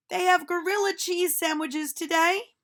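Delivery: put-on a cheerful teacher calling out to children; silly teacher voice. Teacher reads menu.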